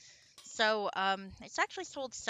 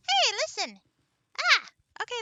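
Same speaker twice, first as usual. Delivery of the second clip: much higher, louder fairy squeak; pixie voice; 10 dB louder than usual. Acted. Streamer trying the high pixie voice as a fairy's call. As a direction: chirp it like a nagging fairy guide. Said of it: "not quite right for the pixie voice".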